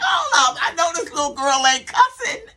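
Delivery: high-pitched, scandalized disbelief; exaggerated outraged double take; mock shocked reaction. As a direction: pitch leaps up as if caught off guard. Retelling own shocked reaction.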